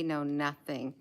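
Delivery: ordinary talk, nothing marked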